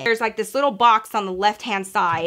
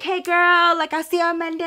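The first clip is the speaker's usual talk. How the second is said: singsong goodbye; 7 semitones higher than usual. Retold or imitated. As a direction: sing out a chirpy goodbye, retold. Chirpy goodbye to a coworker, retold.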